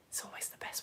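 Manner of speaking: a soft conspiratorial whisper; whispered aside. Real, not acted. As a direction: whisper it like a secret. Confiding aside to viewers.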